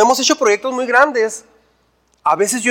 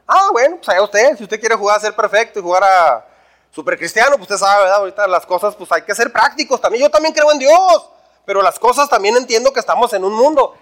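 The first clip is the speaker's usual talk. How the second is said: scoffing self-justification. Acted. Fixer answers a customer who refuses to cheat, scornfully defensive. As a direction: brush off the scruples and defend yourself.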